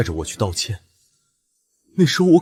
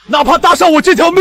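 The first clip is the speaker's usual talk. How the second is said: higher, louder oath; fierce vow; 6 dB louder than usual. Acted. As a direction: swear it with everything. Swears revenge at grave.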